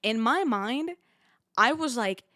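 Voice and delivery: normal talking voice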